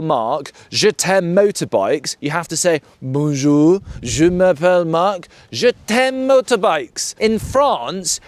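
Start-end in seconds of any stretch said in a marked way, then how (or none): 3.0-7.2 mock-French schoolroom accent; exaggerated French accent. Shown as a demonstration. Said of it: "teach us to speak French with an accent".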